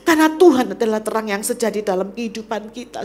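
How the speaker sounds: loud, mounting pulpit declaration; full-force preaching. Acted. Preacher declaring to the church who the light is, forcefully. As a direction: proclaim each phrase with rising force.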